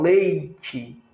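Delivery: leite drawn out to expose the I glide after E; I as semivowel. Shown as a demonstration. Teacher showing the semivowel in leite, drawn-out glide. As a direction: stretch the ei in leite slowly. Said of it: "eu tenho o I semivogal".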